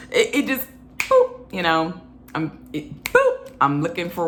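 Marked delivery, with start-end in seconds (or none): 1.0-1.5 boop squeaked high; high squeaky boop. Pretend. Sound effect word. 2.7-3.5 high squeaky boop. Pretend. Sound effect word.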